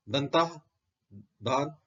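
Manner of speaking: usual speaking voice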